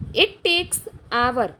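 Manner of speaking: very slow, deliberate reading; slow dictation read. Shown as a demonstration. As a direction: read each word out slowly. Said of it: "reading exam sentence slowly".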